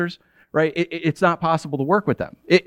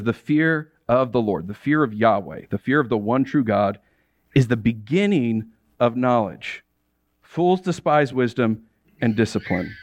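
slow, lower, weighty reading of the verse; solemn scripture reading; 6 semitones lower than usual. Acted. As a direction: read each "fear" heavy and slow, like holy writ.

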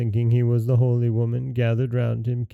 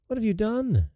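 princess crying out; 10 semitones higher than usual. Acted. Princess seeing the holy woman stabbed, startled high cry. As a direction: gasp out the question in shock. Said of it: "cried the princess".

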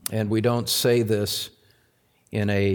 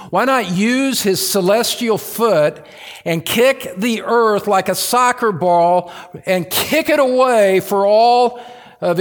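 much higher, louder hyperbolic preaching; full-force preaching; 8 semitones higher, 7 dB louder than usual. Acted. Pastor driving home a hyperbole, high and loud. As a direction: thunder out the image.

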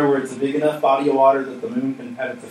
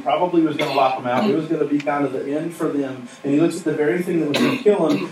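normal talking voice

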